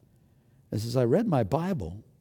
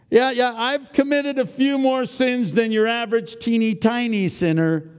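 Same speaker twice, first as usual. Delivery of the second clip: a lightly higher, put-on sheepish sinner; mock congregant voice; 7 semitones higher than usual. Preacher voicing a sheepish churchgoer, lightly higher. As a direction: play the sinner owning up, a bit sheepish.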